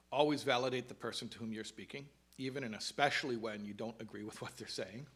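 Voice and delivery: earnest read-aloud of a written text. Acted. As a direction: read the passage like a heartfelt mission statement. Reads from her own introduction.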